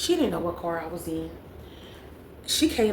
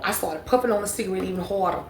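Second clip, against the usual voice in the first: ordinary talk, nothing marked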